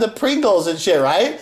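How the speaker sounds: usual speaking voice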